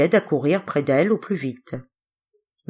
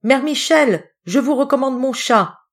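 called out, louder and higher; calling out; 8 semitones higher, 4 dB louder than usual. A narrator's reading. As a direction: call out from the departing carriage. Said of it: "en criant".

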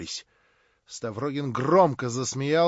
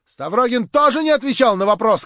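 loud outcry, climbing higher; shouted accusation; 7 semitones higher, 9 dB louder than usual. A narrator's reading. Girl student accuses Stavrogin as he rises, crying out. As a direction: point him out loudly to the room. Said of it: "крикнула студентка".

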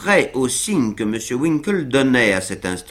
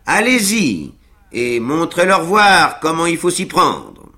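higher, louder cheerful push; encouraging Sam; 7 semitones higher, 5 dB louder than usual. A narrator's reading. Sam cheering his master on, encouraging and loud. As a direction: egg him on like a proud servant. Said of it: "sur un ton encourageant".

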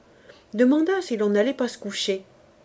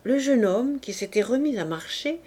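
ordinary delivery

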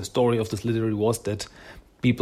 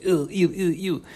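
higher, squeamish ew-ing; disgusted ew; 6 semitones higher than usual. Retold or imitated. Jennika freed from the slime, grossed out. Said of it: "She's like".

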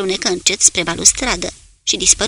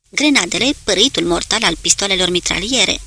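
usual speaking voice

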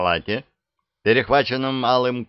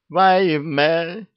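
dismayed exclamation; 7 semitones higher than usual. Acted. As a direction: throw up your hands, wailing. Merchant sees his fruit scattered.

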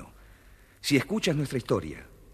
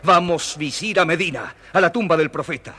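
higher and louder, urging on; rally the vizier to set off; determined commanding call; rousing call; 7 semitones higher, 8 dB louder than usual. Acted. Caliph rallies vizier to leave.

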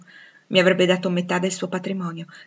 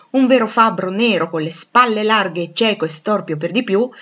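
ordinary delivery

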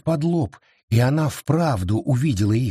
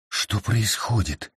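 frightened; 4 semitones lower, 3 dB quieter, slower than usual. A narrator's reading. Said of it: "испугалась Абита".

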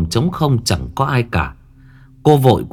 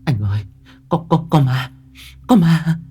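stammering, climbing much higher and faster; terrified; 7 semitones higher than usual. A narrator's reading. Wife clinging to husband after seeing a ghost, panicked stammer. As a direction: stutter in panic, pitch shooting up. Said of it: "Thảo vội vàng ôm lấy chồng".

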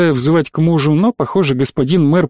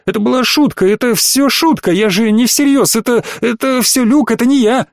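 much higher, panicky denial; a scared boy blurting excuses; frightened excuses; 7 semitones higher than usual. A narrator's reading. Cadet caught out before the adults, higher and frightened. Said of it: "Денис испуганно сжался".

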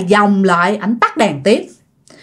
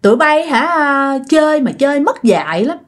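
high-pitched retold ghost, insistent but not shouting; storyteller's high ghost voice, airing grievances; possessing ghost's voice; 6 semitones higher than usual. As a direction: play a wronged spirit speaking through someone, pitched well up. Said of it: "nhập vô la hét rất là dữ".

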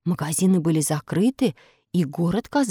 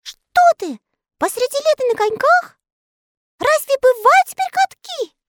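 high squeaky disbelief; incredulous elves; 6 dB louder than usual. Acted. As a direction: scoff in tiny voices. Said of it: "послышалось со всех сторон". (недоверчиво)

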